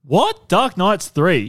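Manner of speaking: high, loud, slow stunned question; shocked disbelief. Real, not acted. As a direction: gasp it out in disbelief. Shocked at box office rank.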